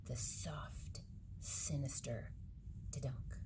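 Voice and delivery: soft, spooky whisper; eerie hush. A narrator's reading. Narrating creeping carrots in a hushed whisper.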